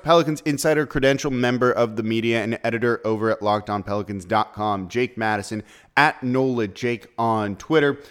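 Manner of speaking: ordinary delivery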